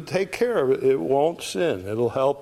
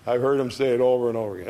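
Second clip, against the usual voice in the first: ordinary talk, nothing marked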